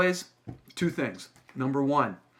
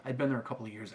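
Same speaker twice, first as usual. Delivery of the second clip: ordinary delivery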